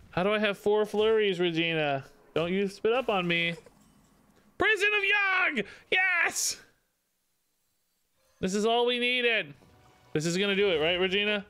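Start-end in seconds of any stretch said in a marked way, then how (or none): 4.6-6.6 high triumphant shout; excited cheer. Real, not acted. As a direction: cheer the lucky card. Great card comes up.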